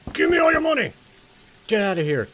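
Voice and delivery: high, loud mock demand; mock robber. Robbing the uncle, high loud mock threat. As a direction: squeal it like a cartoon crook.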